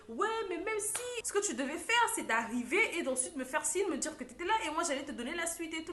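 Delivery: high, shrill-pitched scolding; angry bride yelling. Retold or imitated. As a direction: scold her like a furious bride. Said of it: "Elle était en train de crier". (mariée qui gronde, voix haute)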